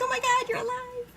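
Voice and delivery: high-pitched imitation of joyful relief; gleeful squeal. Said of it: "gleefully hugging them".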